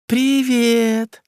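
put-on high, drawn-out affected girly voice; affected drawl. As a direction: coo it out, mannered and sugary. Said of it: "жеманно протянула Барби".

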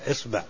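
إصبع with an opening "i": isba'; first vowel of إصبع as "i"; kasra on hamza. Shown as a demonstration. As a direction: say إصبع starting on a short "i". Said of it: "وكسرها".

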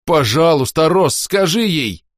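high loud slow begging; desperate plea. A narrator's reading. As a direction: beg your friend to stop her. Whisper begging.

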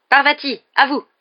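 high, loud teacher's call. A narrator's reading. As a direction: summon the next pupil loudly. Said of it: "le professeur Lupin appela alors". (appel lancé d'une voix forte)

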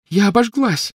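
high pained cry; hurt and burned; moaning in pain. A narrator's reading. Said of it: "простонала Гермиона".

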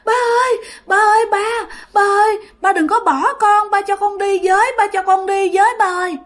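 very high, insistent pleading; child begging dad to take them along; crying, wailing child. Acted. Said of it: "Nó cứ khóc liên tục và nó la".

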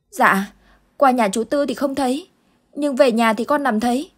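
put-on a small boy still shaken by the nightmare; frightened little boy. Boy answering Uncle Tư about the dark figures, frightened child voice. Boy's voice held.

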